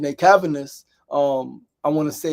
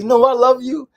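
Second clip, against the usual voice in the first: high-pitched, loud gushing; imitated drunk woman; 9 semitones higher, 10 dB louder than usual. Drunk Janae walking beside uncle and niece, loud and effusive. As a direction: insist on it like a tipsy friend. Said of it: "She's drunk".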